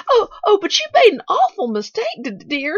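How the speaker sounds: high, loud cry of alarm; faltering, shocked. A narrator's reading. Nancy mistaken for Aunt Polly, faltering in alarm. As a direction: stammer in shocked terror. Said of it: "she faltered".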